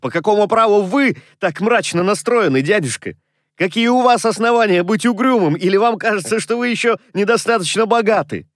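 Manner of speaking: high, merry bantering retort; cheerful. A narrator's reading. Nephew teasing his gloomy uncle, cheerful. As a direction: throw the question back cheerily, pitched high. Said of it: "весело отозвался племянник".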